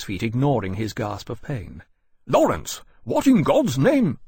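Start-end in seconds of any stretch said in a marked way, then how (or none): 2.3-4.2 high, loud astonished outburst. Acted. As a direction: caught off guard, voice leaping up. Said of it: "said in astonishment".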